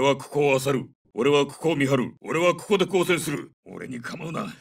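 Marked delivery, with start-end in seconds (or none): 3.6-4.5 low, hushed and strained; weakened soldier. Acted. Hurt soldier tells comrades to leave him, low and weak. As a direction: push the words out low and soft.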